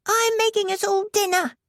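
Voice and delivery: put-on high child voice; little boy. A narrator's reading. Ricky offering dinner to guests, high child voice. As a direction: offer it proudly like a small boy.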